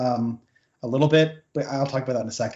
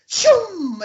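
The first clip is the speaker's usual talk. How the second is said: high, loud cartoon whoosh sound; 8 dB louder than usual. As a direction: make the zoom of a sled shooting off. Cartoon sliding sound effect.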